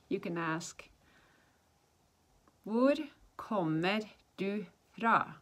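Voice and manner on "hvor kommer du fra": very slow, one word at a time; slow teaching model. Shown as a demonstration. Teacher showing how to ask where someone's from, slow demonstration. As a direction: separate each word for learners to copy.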